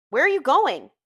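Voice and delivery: alarm. A narrator's reading. Gatsby stopping his departing friend, in immediate alarm. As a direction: demand it, a touch alarmed. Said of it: "demanded Gatsby in immediate alarm".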